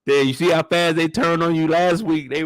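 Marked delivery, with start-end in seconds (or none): none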